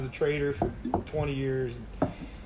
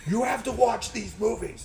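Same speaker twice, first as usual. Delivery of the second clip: higher, slow insistent plea; mock insistence; 6 semitones higher, slower than usual. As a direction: press each word on a reluctant listener. Urging his little sister, quoted.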